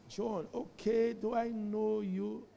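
slow, soft mock aloofness; snooty attitude. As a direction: play someone coolly pretending not to know him. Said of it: "put on one Satan attitude".

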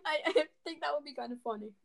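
fast, laughing through words. Real, not acted. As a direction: giggle while you say it. Laughing at curry joke.

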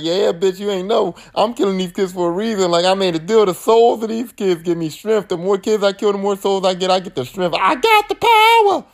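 high gloating villain voice, climbing to a squeal; hammed-up villain bragging; mock Freddy gloat. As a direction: boast about your power. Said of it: "And he's like".

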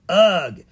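loud high ugh; disgusted groan. Acted. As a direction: groan at the stench. Smelling the fish shop.

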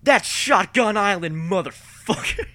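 high, loud, drawn-out battle cry; imitated scream. Friend answers what is in the distance, screaming. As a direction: scream it slowly, like a rallying call. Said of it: "She would just scream".

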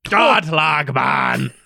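mock Scottish accent, drawn out slowly and shouted; a hammed-up Scottish sergeant, slowly; slow Scottish accent retry. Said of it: "that's Irish".

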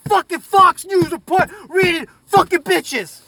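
high, loud ranting shout; furious high-pitched tirade; escalating rant. Acted. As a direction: rage at the deniers at full blast.

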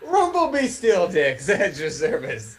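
slow, loud, pompous self-announcement; a hint of a stately butler; mock grand introduction. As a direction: announce the silly name like a herald.

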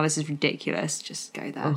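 low, fading to a near-whisper; exasperated muttering. Retold or imitated. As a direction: give up and mutter it away. Retold exasperated aside to sleeping passenger.

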